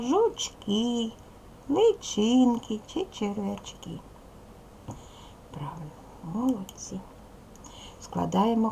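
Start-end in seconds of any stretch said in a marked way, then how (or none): 5.5-7.0 soft whispered praise to the class. Real, not acted. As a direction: breathe the praise out without voice.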